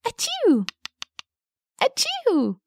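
high fake sneezes. Shown as a demonstration. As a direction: sneeze it out twice. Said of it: "This sneezing woodpecker".

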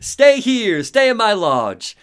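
loud, high command with laughter behind it; hammed-up overbearing doctor; Hartman. Doctor ordering Alan to stay in his lodge, hammed-up command. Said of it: "Hartman is like".

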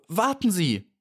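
high bee voice calling out; eager call. A narrator's reading. The little bee Maja calls to the stranded beetle, high. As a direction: call to him to hold on. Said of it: "rief sie".

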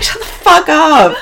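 high, loud, drawn-out disbelief; mock outrage. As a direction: act out loud incredulity. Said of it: "I was like".

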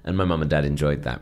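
normal talking voice

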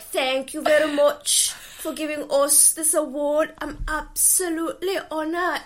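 playful mock Geordie acceptance speech; Geordie accent. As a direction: thank them as a Newcastle local would. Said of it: "with an accent that sounds exactly like yours".

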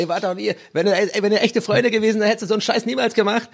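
high, fast, furious reproach; angry. Pretend. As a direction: snap it like a betrayed friend. Acted quarrel between hosts.

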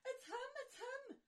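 very high giddy squeal; swooning squeal. Acted. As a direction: fangirl shriek. Girls swoon at prince.

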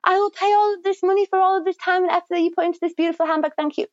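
lightly hammed-up handbag buyer; mock grateful buyer. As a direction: gush like a happy customer. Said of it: "So I'm like".

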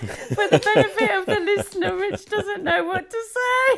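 high, loud laughing announcement; laughing aloud. Real, not acted. Teasing speechless co-host for the listener, helpless loud laughter. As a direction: force the words out through loud laughter.

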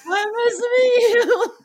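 slow, very high, loud squeak of a greeting; squeaky starstruck greeting. Retold or imitated. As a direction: greet your idol in a cracking squeak. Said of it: "Your voice went up, like, three octaves".

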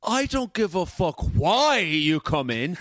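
slow, high, exasperated mock shout; hammed-up furious director; director's temper. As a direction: let rip, stretching each word high. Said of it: "the infamous Gary's temper".

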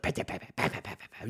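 breathy, barely voiced pat pat pat; soft unvoiced mock chatter; muttered pats. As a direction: mimic a hushed tapping mutter. Mimicking a couple's muttered fight.

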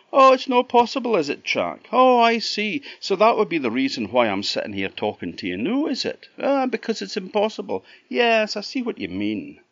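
high, loud, fast mock agreement; sarcastic robin. Robin mocking Jack's disbelief, high sarcastic bird voice. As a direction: tease him with sarcasm. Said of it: "a sarcastic robin".

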